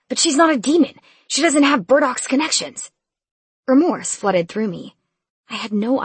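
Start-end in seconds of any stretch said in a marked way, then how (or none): 0.1-2.9 upset. A narrator's reading. Brother upset about mom.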